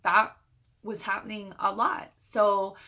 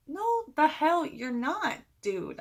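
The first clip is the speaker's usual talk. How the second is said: high, drawn-out protest; acted-out outraged objection; indignant refusal; 7 semitones higher, slower than usual. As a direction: shut a friend down, pitched way up and slow. Acted protest to friend coming along.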